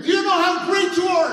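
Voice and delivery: high, forceful scream. Retold or imitated. Counselor screaming at the angry boy walking to her. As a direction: scream it to stop someone advancing. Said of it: "And she's screaming".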